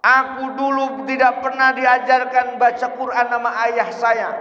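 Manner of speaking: high-pitched wailing complaint; crying out from hell. Acted. Child in hell blaming the father, crying out. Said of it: "anakmu di neraka teriak".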